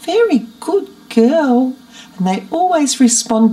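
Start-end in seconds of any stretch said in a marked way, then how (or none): 0.0-1.7 doting pet talk; mothering voice. Shown as a demonstration. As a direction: coo at a good pet. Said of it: "use this as a mothering voice".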